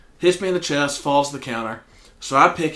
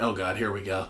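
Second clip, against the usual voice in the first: softer, lower resigned under-the-breath groan; dreading mutter; 5 semitones lower, 10 dB quieter than usual. Retold or imitated. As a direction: mutter it like you're bracing for trouble. Dreading the returning customer.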